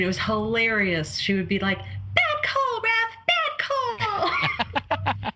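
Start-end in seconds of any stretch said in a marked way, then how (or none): 1.8-5.3 imitating a squeaky girl heckling the ref; very high squeak, last call drawn out. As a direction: squeal it like an eight-year-old criticizing the referee. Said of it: "she had this high, squeaky voice".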